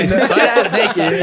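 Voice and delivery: slow, laughing delivery. Real, not acted. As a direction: laugh out the words. Laughing at own story.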